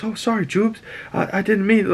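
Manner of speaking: high, sorry little voice; sheepish apology. Retold or imitated. Sunspot apologizing after hitting Jubilee, sheepish and high. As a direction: apologize like you slipped up. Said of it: "Sunspot hits Jubilee and goes".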